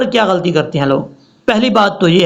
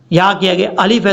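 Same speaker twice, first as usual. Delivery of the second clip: ordinary talk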